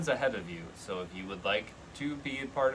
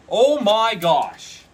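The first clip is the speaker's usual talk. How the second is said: high, slow, loud squeal of disbelief; excited shriek; 10 semitones higher, 14 dB louder, much slower than usual. Real, not acted. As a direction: shriek with delight. Card she wanted flips out.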